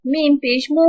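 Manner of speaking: measured sing-song spelling, long held final syllables; Qaida spelling drill. Shown as a demonstration. Teacher spelling tanween words aloud for children, sing-song. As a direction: chant each letter and sound like a reading drill.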